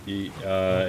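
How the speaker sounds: ordinary delivery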